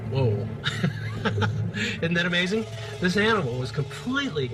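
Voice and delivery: wow, can you believe it; amazed laugh. Real, not acted. Octopus suddenly appears on video.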